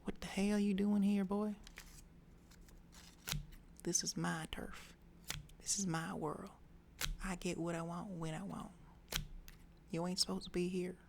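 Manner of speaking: put-on girl warning the boy off her turf, mildly tough. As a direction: push him off your turf, half-voiced. Girl confronts boy, tough talk.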